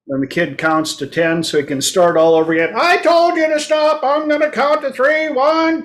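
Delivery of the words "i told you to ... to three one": mock stern parent. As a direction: play the fed-up parent warning a kid, pitched way up. Parent counting again, fed up.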